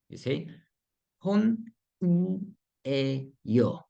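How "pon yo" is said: slow split take. Shown as a demonstration.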